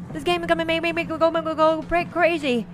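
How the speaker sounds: ordinary talk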